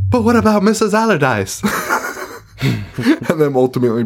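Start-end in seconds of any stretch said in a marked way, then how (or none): 0.1-1.6 high, whiny protest; mock pleading mom. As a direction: caricature a fretting mom, pitched way up. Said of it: "the mom being like".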